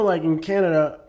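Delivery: normal talking voice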